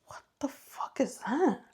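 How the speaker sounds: hushed, breathless whisper of alarm; scared whisper. Retold or imitated. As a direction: whisper it as if someone might hear. Frightened, woken by strange noises.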